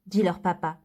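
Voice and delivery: ordinary delivery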